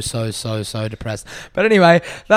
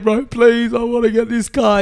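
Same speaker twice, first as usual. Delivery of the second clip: pleading; 9 semitones higher, slower than usual. Retold or imitated. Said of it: "I was like".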